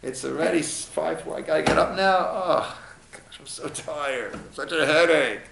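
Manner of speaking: acted weary waking-up complaint; mock tired whining; bad morning attitude. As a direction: grumble like someone dragging themselves up. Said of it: "very often our attitude".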